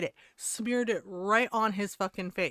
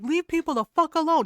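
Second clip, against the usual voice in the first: loud, higher retold telling-off; imitated yelling; 6 semitones higher, 7 dB louder than usual. Woman defending herself from pranksters, imitated yell. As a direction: act out her forceful yell. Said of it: "She yelled, she's like".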